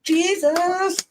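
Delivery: high-pitched revival exclamation; mock preacher cry. Woman joins friend's preaching act, mock revival cry. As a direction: cry it out high like a congregation member.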